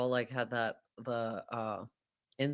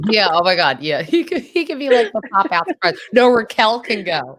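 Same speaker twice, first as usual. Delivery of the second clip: high, loud and giddy, squealing the idea out; excited squeal; 9 semitones higher, 10 dB louder than usual. Real, not acted. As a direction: gush it like the best idea ever. Excited gossip about surprise guest.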